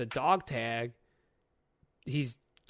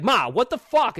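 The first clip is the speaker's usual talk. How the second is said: angry son; 8 semitones higher, 7 dB louder than usual. Retold or imitated. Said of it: "He's like".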